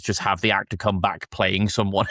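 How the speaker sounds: usual speaking voice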